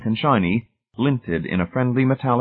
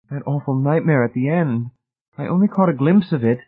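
higher-pitched, shaken dialogue; acted distress, pitched up; shaken recollection; 7 semitones higher than usual. A narrator's reading. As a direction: recall the nightmare with rising alarm. Shaken survivors recall the nightmare.

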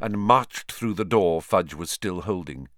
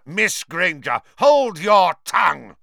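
angry snap; 9 semitones higher, 8 dB louder than usual. Acted. Snape snapping at Hermione.

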